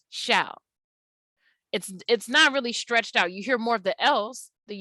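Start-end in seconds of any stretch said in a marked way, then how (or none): 0.1-0.6 "shall" with a short A closed by the L; cut-off vowel. Shown as a demonstration. Teacher showing the vowel doesn't stretch, short-vowel "shall". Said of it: "the L's at the end kinda cut it off".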